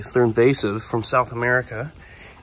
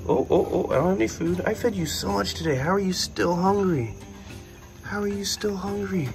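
higher, fond scolding tone; doting pet talk; 7 semitones higher, 3 dB quieter than usual. Real, not acted. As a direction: tease the greedy pet lovingly. Scolding hungry pet fondly.

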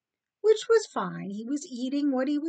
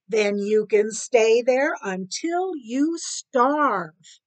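loud, very slow, weighted words; spiteful threat; 7 dB louder, much slower than usual. Acted. Cat to rat in hole, spiteful. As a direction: stretch it out as an angry ultimatum.

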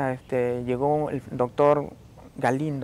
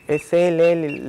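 "Es él" dicho fuerte y firme, señalándolo; señalando con fuerza; 3 semitones higher, 8 dB louder, much slower than usual. Retold or imitated. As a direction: apunta y afirma en voz alta, sin duda. Identifying the policemen behind door. (la víctima identifica a sus agresores en voz alta y firme)

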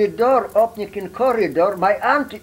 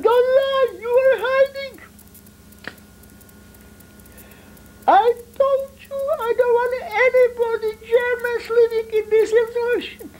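scolding grandmother. Retold or imitated. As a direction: squeak it out like a fussing grandma. Grandmother scolds the hidden friend.

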